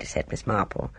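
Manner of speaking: usual speaking voice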